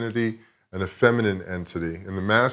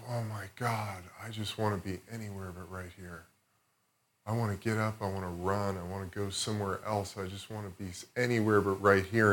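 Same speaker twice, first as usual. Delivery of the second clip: much softer, a desperate inner plea; panicked inner thought; 9 dB quieter than usual. Retold or imitated. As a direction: say it under your breath, wanting to escape. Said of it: "I'm thinking to myself".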